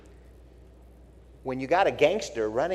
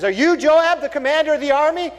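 high, louder woman's voice asking; 12 semitones higher, 7 dB louder than usual. Retold or imitated. Woman on the wall asks Joab who he is, high woman's voice.

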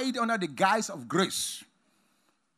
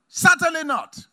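high, loud certainly not; emphatic refusal; 10 semitones higher, 9 dB louder than usual. Acted. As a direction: ring out the denial. Paul's answer, pitched high.

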